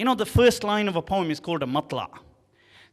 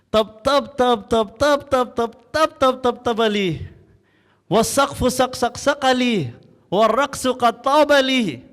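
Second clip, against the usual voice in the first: poem recitation; 5 dB louder than usual. Acted. Bedouin poet recites his tongue-twister poem before the caliph, building to a loud fast chant. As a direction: recite it rhythmically, picking up speed and volume as it goes.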